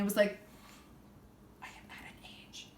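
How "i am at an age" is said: breathy deadpan whisper; fast flat whisper. Retold or imitated. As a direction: hiss it under your breath like a secret. Whispered retort to woman.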